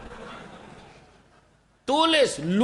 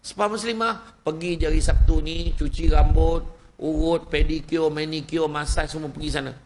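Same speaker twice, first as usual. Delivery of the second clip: ordinary talk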